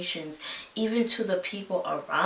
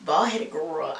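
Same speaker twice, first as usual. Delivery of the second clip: ordinary talk, nothing marked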